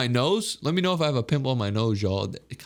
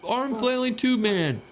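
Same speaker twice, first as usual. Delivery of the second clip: high, slow disbelief; drawn out, amazed at the sight; baffled; 9 semitones higher, slower than usual. Real, not acted. Baffled at flailing man.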